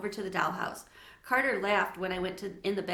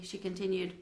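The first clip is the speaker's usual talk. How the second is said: plain reading voice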